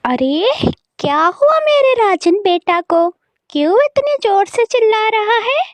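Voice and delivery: high, fussing concern; alarmed doting mother. Acted. Mother asks why her son is yelling, alarmed. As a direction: fret over the son in a high voice.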